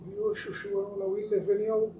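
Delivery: imitating others.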